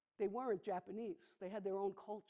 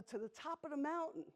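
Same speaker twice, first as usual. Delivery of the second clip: higher, lightly voiced little princess; princess Annie; 7 semitones higher than usual. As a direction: answer brightly as the young princess. Princess Annie answers the sheep.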